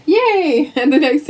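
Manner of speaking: high happy yay; excited cheer. Real, not acted. Cheers about a day off.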